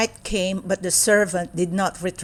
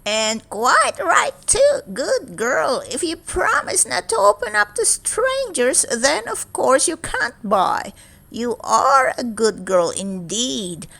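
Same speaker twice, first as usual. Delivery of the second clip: velvety witch; 6 semitones higher than usual. A narrator's reading. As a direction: tempt her with sweet witchy charm. Witch voice again.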